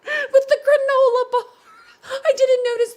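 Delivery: high, loud burst through laughter; laughing shriek. Real, not acted. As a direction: squeal it while cracking up. Spots the funny picture.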